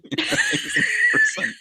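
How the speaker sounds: high, laughing mimicry of a woman; laughing imitation. Imagining bystanders hearing the ringtone, laughing imitation. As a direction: mimic her through laughter. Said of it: "that woman with that accent".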